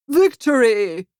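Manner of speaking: loud, slow cry of victory; triumphant yell. A narrator's reading. Protest leader Ralph celebrating the overturned car, loud. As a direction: crow it over the wrecked car. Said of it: "Ralph yells".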